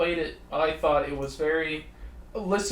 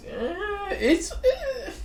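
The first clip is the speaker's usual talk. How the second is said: very high, squeaky "eh"; hesitant waffling; much slower than usual. Real, not acted. Co-host unsure how to describe the game's gyms, high waffling. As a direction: waver on drawn-out high noises of doubt.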